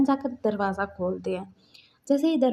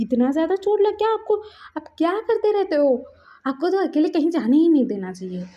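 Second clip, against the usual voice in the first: raised worried scolding; 6 semitones higher, 4 dB louder than usual. Retold or imitated. Worried scolding over injury.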